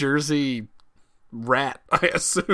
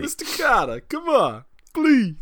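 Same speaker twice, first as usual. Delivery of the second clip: high, pleading Jersey schoolboy; John Travolta; 11 semitones higher, 3 dB louder, slower than usual. Acted. Student begs his teacher, mock Jersey whine. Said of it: "John Travolta from Welcome Back, Kotter".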